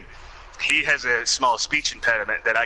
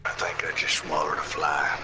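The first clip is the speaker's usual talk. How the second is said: put-on Carl Childers voice; 7 semitones lower, 4 dB quieter than usual. Both mimicking Carl.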